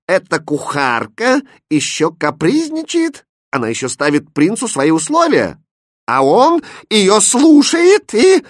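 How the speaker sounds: high, scandalised princess tirade; indignant outrage. A narrator's reading. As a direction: build to a loud shriek of disbelief.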